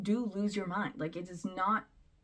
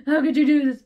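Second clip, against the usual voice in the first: high, loud fake-wounded wail; hammed-up sobbing outrage; mock crying accusation; 9 semitones higher, 17 dB louder than usual. Mocking the wife staging a tearful scene, shrill fake-hurt cry. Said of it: "she's crying and all upset".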